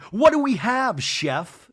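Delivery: mock fancy host; mock pompous. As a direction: announce it grandly. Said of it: "Jesse's like".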